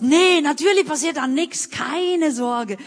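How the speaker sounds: high, loud, carefree brush-off; breezy reassurance. Retold or imitated. Friends assuring her the cash is safe, breezy and loud. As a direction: wave the worry away cheerfully.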